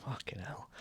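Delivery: voiceless, very soft retold reaction; hushed, private mock disbelief; whispered aside. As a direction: whisper it under your breath while watching. His muttered reaction while watching.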